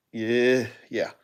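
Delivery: ordinary delivery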